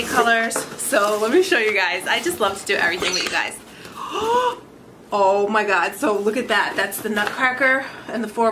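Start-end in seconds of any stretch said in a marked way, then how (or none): 3.8-4.6 high, quick gasp of surprise; sharp gasp. Real, not acted. Opening a PR package on camera, a high surprised gasp. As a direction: gasp as if the box just dazzled you.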